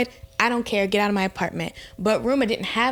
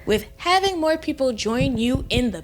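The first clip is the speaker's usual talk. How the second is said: high emphatic; 7 semitones higher than usual. Real, not acted. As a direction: preach it at them. Emphatic warning to friends.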